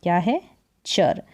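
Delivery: ordinary delivery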